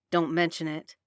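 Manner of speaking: low curt father voice; gruff man voice. Acted. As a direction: brush it off, low and short. Logan answers curtly.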